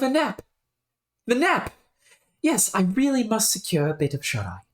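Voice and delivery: high, eager British exclamations; British gentleman thrilled at the idea of a nap; excited British accent. Acted. Scholar remembering his nap, excited British accent. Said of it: "whenever I break accent".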